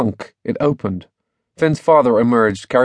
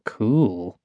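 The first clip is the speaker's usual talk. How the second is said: inner thought; 4 dB quieter than usual. A narrator's reading. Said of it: "thought Finn".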